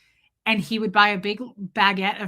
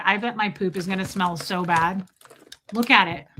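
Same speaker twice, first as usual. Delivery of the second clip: usual speaking voice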